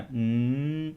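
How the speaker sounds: soft sustained mmm; m sound hum. Shown as a demonstration. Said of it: "सिर्फ म का sound करना है". (हल्की म की ध्वनि)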